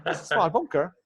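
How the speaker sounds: a light mock Cork lilt from the wife; Cork accent. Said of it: "actually has a Cork accent".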